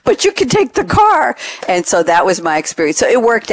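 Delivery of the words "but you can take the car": high, singsong salesman punchline; retold gleeful offer. Salesman handing over car without bow, mock-gleeful. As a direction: imitate an upbeat salesman, pitched way up.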